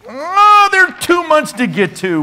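high, loud wail of exasperation; dismayed groan. Real, not acted. Dismayed at running out of time.

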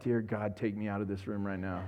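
low, soft mock mortified plea; mortified daughter. Pastor imitates his embarrassed daughter, low and soft. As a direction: mutter it like a daughter dying of embarrassment. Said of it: "Haley's like".

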